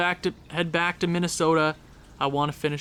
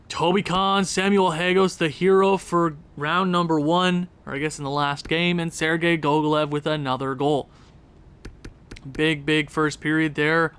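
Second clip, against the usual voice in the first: ordinary talk, nothing marked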